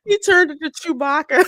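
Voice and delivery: high, loud, laughing tease; cracking up while teasing. Real, not acted. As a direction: say it through real laughter. Teasing co-host while both laugh.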